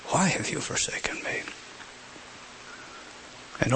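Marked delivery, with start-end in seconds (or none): 0.1-1.4 a forsaken plea, barely voiced; whispered cry. Cry of Christ on the cross.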